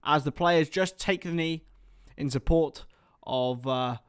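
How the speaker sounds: building match commentary, rising into the kick-off; live play-by-play. Acted.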